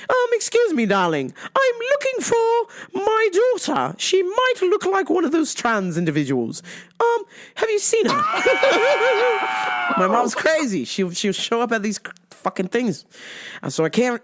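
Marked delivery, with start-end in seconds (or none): 0.1-8.2 a high genteel mother asking politely; mom impression. Mimicking mom searching for her daughter in a high prim voice. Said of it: "She talks like this". 8.3-9.9 high laughing shriek. Real, not acted. Laughing at own impression.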